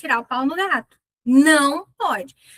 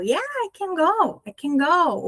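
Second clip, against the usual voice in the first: "go" is the strong word, slow and affirming; affirming "I can go" with weight on "go"; stress on go; 6 semitones higher than usual. Shown as a demonstration. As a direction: land firmly on "go". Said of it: "O go é o forte".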